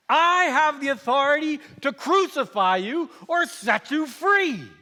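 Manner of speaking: high, loud, browbeating tirade; furious shouting. Acted. Governor threatening a silent prisoner, furious shouting. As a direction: bellow your authority, hammering the key words.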